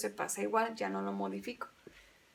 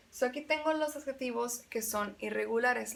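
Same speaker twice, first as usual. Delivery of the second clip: normal talking voice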